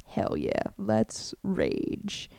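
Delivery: whispered hell yeah; hushed whisper. Retold or imitated. Quietly to himself, whispering excited at the cash. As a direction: whisper it like a secret thrill. Imagined party reaction.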